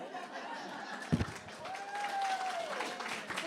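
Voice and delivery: high relieved burst of laughter. Real, not acted. Relieved laugh after finishing.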